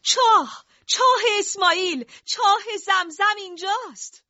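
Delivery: high, loud ecstatic cry of discovery; joyful shout. Acted. Abdul-Muttalib shouting to everyone that he found Zamzam, ecstatic high shout. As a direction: yell it so all of Mecca shakes. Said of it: "چنان فریادی زد که مکه تکون خورد".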